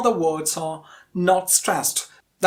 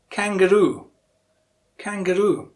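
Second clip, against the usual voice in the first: KANGAROO with a long, strong ROO at the end; kanga-ROO, lean on the last syllable of kangaroo; last-syllable stress; 4 dB louder than usual. Shown as a demonstration. Teacher quizzing learners, stressed on ROO. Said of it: "yes, the last syllable".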